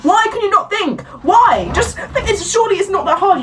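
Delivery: high, fed-up scolding; high-pitched exasperated scolding; exasperated rant. Real, not acted. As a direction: rant at the clueless stranger.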